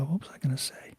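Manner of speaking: whispered to herself; whispering. Real, not acted. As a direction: drop to a whisper, thinking aloud. Musing aloud, losing thread.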